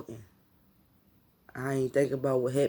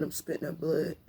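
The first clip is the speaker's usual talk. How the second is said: usual speaking voice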